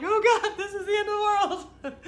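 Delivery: very high, loud mock wail; imitated doomsday panic; hammed-up despair. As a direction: wail like a melodramatic family, half laughing. Mimicking family's overblown upset.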